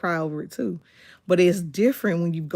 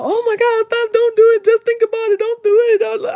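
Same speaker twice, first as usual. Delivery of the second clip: loud, high mock-panicked pleading; over-the-top panic; 8 dB louder than usual. Hostages begging the woman not to do it, hammed-up panic. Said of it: "they were being so extra".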